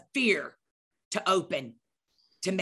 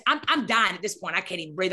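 ordinary talk

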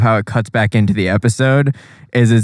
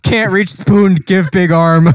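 high, loud caveman-brain grunt; dumb brain voice; 8 semitones higher, 4 dB louder, slower than usual. Retold or imitated. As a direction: play a simple-minded brain barking orders. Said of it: "His brain was like".

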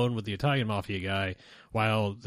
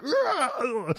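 mock furious sputtering squeaks, way up high; strangled high yelps of rage; imitating a wordless angry outburst. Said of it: "when you get so angry".

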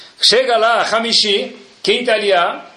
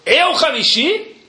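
slow, indignant rising question; mock outrage at being given fifth aliyah; offended disbelief; 8 semitones higher, slower than usual. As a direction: act insulted by the offer. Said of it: "Ele falou".

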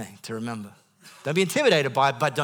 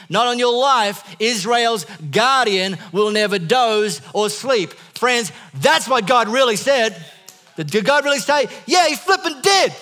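much higher and louder, ringing preacher's voice; preaching at full force; 10 semitones higher, 12 dB louder than usual. Acted. Pastor rebutting the devil's lie to the congregation, full-force preaching. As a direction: proclaim it to the whole church.

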